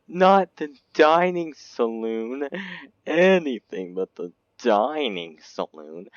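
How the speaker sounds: high slow mock lament; dramatic wail. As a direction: wail it like a tragedy. Mock dramatic wail over room.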